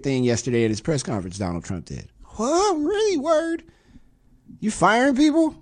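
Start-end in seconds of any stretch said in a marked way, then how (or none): 2.4-3.6 high squeaky what, really, word; sarcastic shocked little cries; mock disbelief. Mock disbelief at Trump reference. 4.6-5.5 high put-on voice teasing the firing; sarcastic squeaky jab; mocking a boss who fires people; mock incredulous voice.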